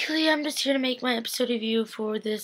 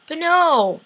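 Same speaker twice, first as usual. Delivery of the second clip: normal talking voice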